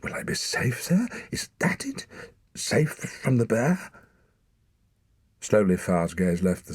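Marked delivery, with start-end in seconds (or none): 0.0-3.9 high-pitched, frightened rush of questions; a boy clinging to any answer; panicked questions. A narrator's reading.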